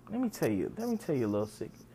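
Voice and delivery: usual speaking voice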